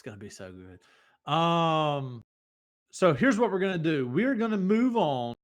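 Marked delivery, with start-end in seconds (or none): 4.1-5.3 segment announcer. Acted.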